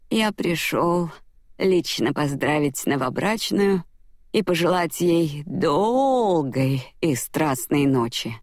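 put-on slow, slurred drunk voice. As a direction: drawl it out like a drunk. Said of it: "отчего его снова повело в сторону, а ноги заплелись".

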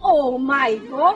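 "God" closer to English, still drawn out; low, slow "Oh my God" with a clearer GOD; correctly pronounced English. Acted. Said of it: "Phát âm đúng".

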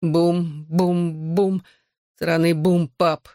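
drugged, thick-tongued mumbling; woozy patient slurring; slurred speech. A narrator's reading. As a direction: let the words loll on a clumsy tongue. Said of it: "заплетающимся языком произнёс Джонни".

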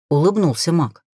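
ordinary delivery

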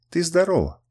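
plain reading voice

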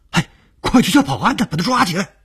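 high, loud, fast angry shout. Acted. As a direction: yell for the guards. Angry crowd calling for security.

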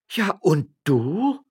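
high, slow, loud counter-question; challenging retort. Acted. Magistrate's wife to her husband, challenging retort. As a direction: draw out the challenge.

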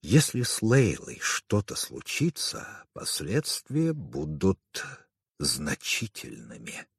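slow, hushed warning; slow down and let the volume sink; soft, weighty threat sinking low at the end. A narrator's reading. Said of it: "Его голос стал тише".

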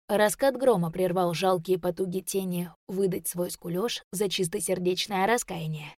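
usual speaking voice

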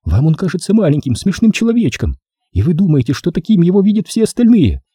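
high female voice, hurt and defiant; woman's voice. Acted. Corola defends herself to the narrator, bitterly.

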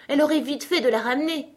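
high, quick exclamation; exclaiming aloud. A narrator's reading. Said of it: "s'exclama Alice à haute voix".

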